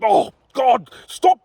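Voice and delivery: king roars at knights bursting into dust; exasperated roar. Acted. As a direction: roar at them in despair. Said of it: "Hurler roared".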